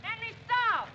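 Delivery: high, loud yells after him; desperate shout. Acted. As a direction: scream for him to stop and return. Chasing the motorcycle, shouting.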